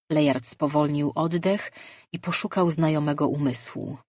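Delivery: ordinary delivery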